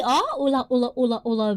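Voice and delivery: normal talking voice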